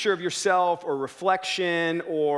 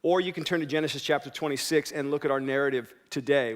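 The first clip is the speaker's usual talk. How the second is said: ordinary delivery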